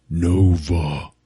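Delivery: lightly voiced solemn ape; slow, low and deliberate, bestowing a name; solemn naming.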